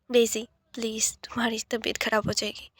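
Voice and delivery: whispered, gently calming; soothing whisper. Acted. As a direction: whisper to calm her down. Brother soothing frightened sister.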